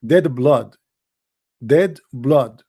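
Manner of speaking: ordinary delivery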